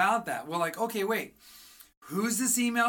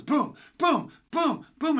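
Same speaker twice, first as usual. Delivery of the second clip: imitated barrage; 7 semitones higher, 5 dB louder, slower than usual. Mimics rapid-fire client questions.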